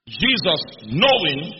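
slow, weighty push on "knowing"; stress on knowing. Shown as a demonstration. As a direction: stretch out "knowing" for emphasis. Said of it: "underline knowing".

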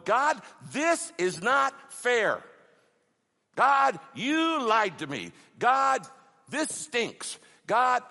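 loud, high, slow cry of complaint; loud lament. Acted. Preacher acts out an angry complaint to God, lamenting loudly. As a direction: cry out to God, drawing out each protest. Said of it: "that's called lamenting".

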